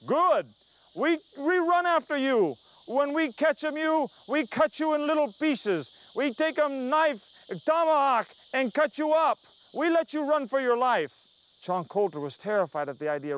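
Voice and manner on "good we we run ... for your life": higher, louder, slowed chief's threat; menacing chief. Retold or imitated. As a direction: taunt him like a chief promising the chase.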